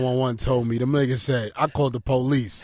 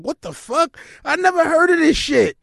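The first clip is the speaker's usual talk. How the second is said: mock surprise; 12 semitones higher, 3 dB louder than usual. Reacting to rasta pasta.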